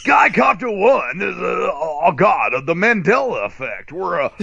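high, excited sky report; mock radio chopper reporter; mock helicopter traffic report.

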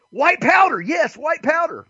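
high-pitched mock enthusiasm; eager cheering. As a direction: cheer along like a keen recruit. Acts himself eagerly joining chant.

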